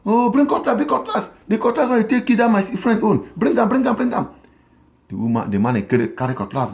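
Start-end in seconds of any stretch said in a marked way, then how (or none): 0.0-4.3 fake panicked cry. Wife faking fear to call her husband back, high panicked cry. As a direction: scream for daddy like the snake is real. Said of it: "The man, he run come".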